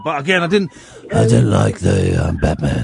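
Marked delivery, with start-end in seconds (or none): 1.1-2.8 very low, slow gruff superhero voice; mock the gruff movie hero, deep and drawn out; gruff Batman voice. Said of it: "start talking like that with a gruff voice".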